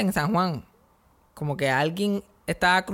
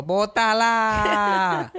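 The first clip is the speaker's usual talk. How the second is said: long drawn-out, higher-pitched call; retold yell across street; 4 semitones higher, much slower than usual. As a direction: holler it across the street, stretching the vowels. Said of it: "yo gritando desde el otro lado de la calle".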